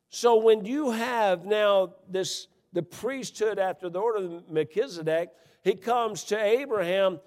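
loud, high, slow sermon delivery; preaching at full force. Acted. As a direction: drive it home to the congregation.

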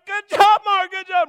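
very loud, high cheering; excited praise. Retold or imitated. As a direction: cheer your fighter on. Coach praising stunned fighter.

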